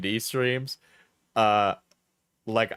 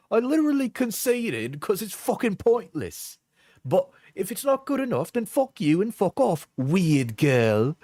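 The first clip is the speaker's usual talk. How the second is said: higher-pitched mock British accent; put-on accent; 4 semitones higher than usual. Reading breakup texts back, high put-on British accent. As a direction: act the texts out in exaggerated British. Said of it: "when I'm doing the accent".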